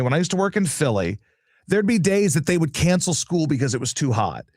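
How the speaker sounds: ordinary delivery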